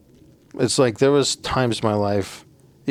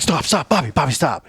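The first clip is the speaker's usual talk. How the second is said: higher, insistent calls of "stop"; urgent warning; 6 semitones higher, 3 dB louder than usual. Retold or imitated. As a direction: make him halt right now. Shouting warning at teammate.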